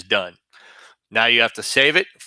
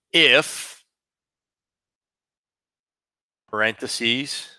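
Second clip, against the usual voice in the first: dictating; 2 semitones lower, slower than usual. Shown as a demonstration. Teacher dictating a formula slowly.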